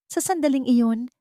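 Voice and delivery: ordinary delivery, nothing marked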